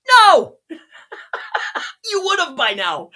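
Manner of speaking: high, loud shouted no; emphatic no. Acted. Coach denies clients ever got penalties, shouting. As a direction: blast the no out. Answers his own question emphatically.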